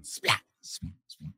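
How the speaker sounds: soft voiceless splat; lightning splat. Acted. Dad reading to a child, lightning splat. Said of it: "very hard to make a noise".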